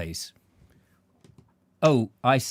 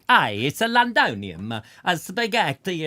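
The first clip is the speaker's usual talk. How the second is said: hammed-up Italian accent, a bit louder; mock Italian accent; 2 semitones higher, 5 dB louder than usual. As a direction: play a cartoon Italian Roman. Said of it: "spoke in an Italian accent".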